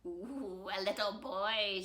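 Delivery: lightly voiced wicked delight; grinning bad fairy. A narrator's reading. Bad Fairy grabs Victor, lightly acted grinning. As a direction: gloat with a grin, lightly acted. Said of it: "she grinned".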